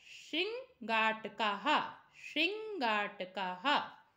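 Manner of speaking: श्रृंगाटकाहा said slowly, ending in a clear "-kaha"; teacher modelling श्रृंगाटकाहा slowly, twice; model pronunciation. Shown as a demonstration. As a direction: read श्रृंगाटकाहा slowly for pupils to copy. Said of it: "ऐसे पढ़ेंगे इसको".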